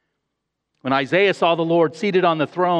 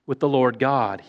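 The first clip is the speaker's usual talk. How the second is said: ordinary talk, nothing marked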